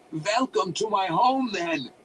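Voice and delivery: slow, high, loud wizard voice; drawn-out, exultant villain welcome; triumphant yell. A narrator's reading. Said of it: "the wizard yelled triumphantly".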